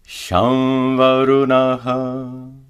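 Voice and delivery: slow Shamvarunaha with a nasalized va; Sanskrit example. Shown as a demonstration. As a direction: chant Shamvarunaha slowly, va through the nose. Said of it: "Notice that the anuswara is pronounced as a nasalized va".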